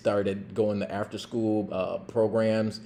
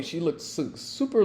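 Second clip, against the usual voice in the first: ordinary delivery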